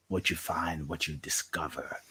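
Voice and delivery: hushed reflective close; soft trailing voice. Real, not acted. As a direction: let it fade to almost nothing. Reflective prompt to listener.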